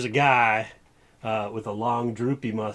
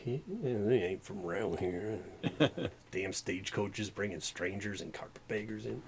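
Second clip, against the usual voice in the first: muttering sheriff; 6 semitones lower, 12 dB quieter than usual. Acted. Sheriff mutters about strangers.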